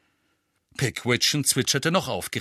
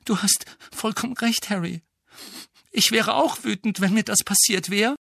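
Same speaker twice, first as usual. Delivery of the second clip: lightly voiced girl; 11 semitones higher, 3 dB louder than usual. A narrator's reading. Hermione voiced higher.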